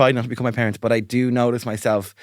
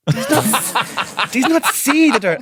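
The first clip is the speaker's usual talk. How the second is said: high, slow, laughing mum scold; laughing mock scold; 8 semitones higher, 4 dB louder, slower than usual. Scolding Raymond as if her child, laughing high mum voice.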